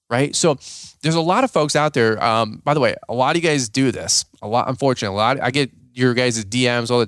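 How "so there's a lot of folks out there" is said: ordinary talk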